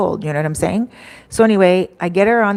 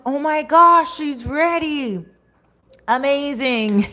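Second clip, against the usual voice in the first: excited relief; 7 semitones higher, slower than usual. Retold or imitated. Thrilled she finally calls.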